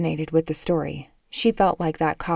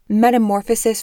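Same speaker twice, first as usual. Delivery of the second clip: louder, higher, flinging the title at him; exasperated outburst; 4 semitones higher, 7 dB louder than usual. Acted. Mother confronts the father over the book, loud exasperated.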